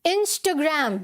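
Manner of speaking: Instagram as "in-stuh-gram"; short "stuh" in Instagram, English "gram"; corrected pronunciation. Shown as a demonstration. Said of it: "क्या है?".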